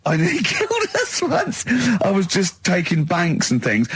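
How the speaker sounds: ordinary delivery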